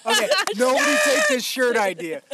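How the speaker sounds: giggling through a pitch; laughing while pitching. Real, not acted.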